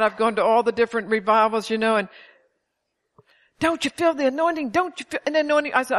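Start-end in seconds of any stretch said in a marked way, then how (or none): 3.6-5.7 raised, pleading preacher voice; lightly mocking evangelist asking; mock revival preacher. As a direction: mimic a pushy revival preacher, pitched up.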